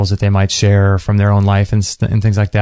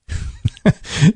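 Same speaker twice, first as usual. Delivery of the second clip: amused laugh; 8 semitones higher than usual. Real, not acted. Laughing at the mispronunciation.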